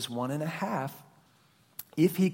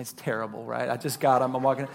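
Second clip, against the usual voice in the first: normal talking voice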